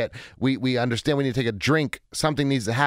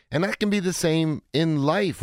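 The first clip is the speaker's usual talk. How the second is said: usual speaking voice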